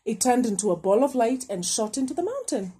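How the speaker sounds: plain reading voice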